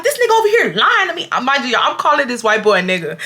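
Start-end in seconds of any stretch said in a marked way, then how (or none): 0.0-1.3 high-pitched indignant complaint; hammed-up disbelief and outrage; outraged accusation. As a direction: act out accusing someone mid-argument. Acted accusation during the argument.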